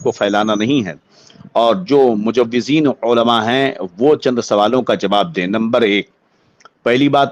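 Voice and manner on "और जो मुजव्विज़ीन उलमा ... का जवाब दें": ordinary talk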